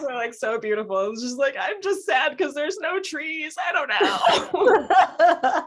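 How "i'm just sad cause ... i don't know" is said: higher, very slow, laughing; laughing mock lament. Recalling crying on the subway, slow laughing lament. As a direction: drag it out through giggles. Retold inner thought while crying.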